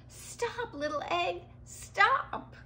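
high-pitched storybook yell; narrated yell. A narrator's reading. Sid chases the bouncing egg, high yell. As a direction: call out high, lifting to the last word.

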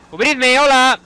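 high, loud panicked cry for cover; panicked shout. Real, not acted. Player under fire begging teammates for cover, panicked shout. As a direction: yell for help while being shot.

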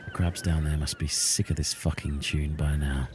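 usual speaking voice

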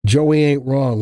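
ordinary delivery

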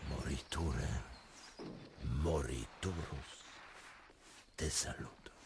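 low, soft, fading to a whisper; grave hushed farewell. Acted. Prince bidding the doomed king farewell, low and hushed. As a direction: let the salute die away.

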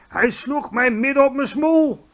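high, loud, outraged yell. A narrator's reading. Giant woken by a blow to the face, high loud yell. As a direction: yell out your complaint. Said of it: "gilde de vleeshakker".

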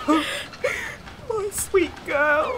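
slow, high, fond laugh into the words; a father soothing his daughter; tender father. Acted.

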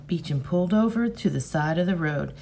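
ordinary delivery

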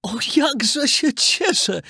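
high, slow, delighted exclamation; overjoyed old carpenter. Acted. Old carpenter rejoices over his puppet, slow and delighted. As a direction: let the joy spill out slowly. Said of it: "zawołał majster".

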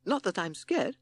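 high, timid toad voice; hurried denial. A narrator's reading. Timid Toad denying he is scared, hurried. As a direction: blurt a nervous denial, pitched high. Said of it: "said Timid Toad hurriedly".

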